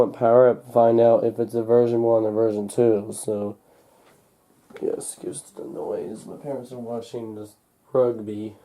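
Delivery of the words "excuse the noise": ordinary talk, nothing marked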